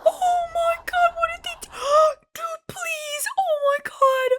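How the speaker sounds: grief-stricken, voice high and cracking; high crying. Real, not acted. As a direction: wail it out through tears. Breaking down over companion's fate.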